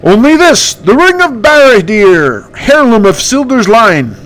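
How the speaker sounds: slow, grand high-voiced hero; Candace voice. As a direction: hero showing off the ring. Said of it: "that's my impression".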